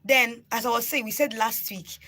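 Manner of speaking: usual speaking voice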